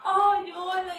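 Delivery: tender voice. Retold or imitated. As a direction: greet yourself lovingly. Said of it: "Y entonces empecé". (saludo tierno y agudo)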